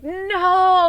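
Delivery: sudden high, loud cry of dismay; panicked no. Retold or imitated. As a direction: yelp it out in panic as the phone dies. Phone dying, panic.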